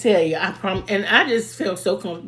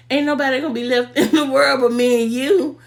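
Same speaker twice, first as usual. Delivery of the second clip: high, loud, tickled exclamation; laughing, teasing quote; 7 semitones higher, 5 dB louder than usual. Retold or imitated. Friend joking her out of her anger, laughing tease.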